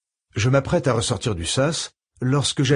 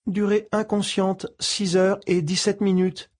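computer voice; 8 semitones higher than usual. Acted. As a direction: read it like a calm medical computer. Ship computer answers.

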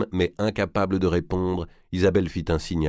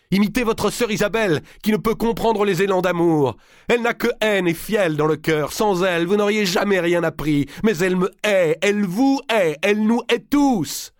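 fureur; 13 semitones higher, 5 dB louder than usual. Acted. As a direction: rage aiguë et forte. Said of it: "un sursaut de fureur". (colère criée, voix haute)

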